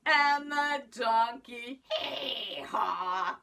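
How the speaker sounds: put-on lively sing-song storytelling for kids; reading to children. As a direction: tell it like a picture book to a class. Teacher reads story to children.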